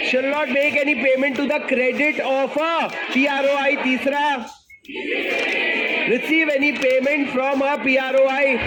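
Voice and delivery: slow, sing-song reading of the clause; drawn-out classroom chant; rote recitation. Shown as a demonstration. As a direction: chant it slowly for the class to repeat.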